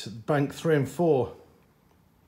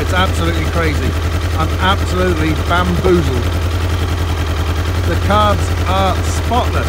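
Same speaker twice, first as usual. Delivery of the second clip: loud, higher-pitched exasperated complaint; raised voice; 6 semitones higher, 13 dB louder than usual. Real, not acted. Mechanic complaining over the noisy bike that keeps failing, raised voice. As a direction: talk up over a running engine.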